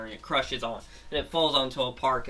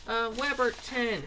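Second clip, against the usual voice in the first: high-pitched frantic warning; panicked townspeople; 12 semitones higher than usual. Retold or imitated. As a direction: cry it out way up high, scared crowd. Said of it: "they're saying".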